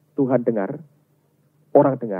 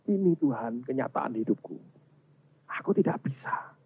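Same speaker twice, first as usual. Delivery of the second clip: hushed, helpless prayer; broken confession; 7 dB quieter than usual. Acted. Believer showing God the truth of life, hushed confession. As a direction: confess it softly, fading into breath. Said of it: "kita teriak dengan Tuhan".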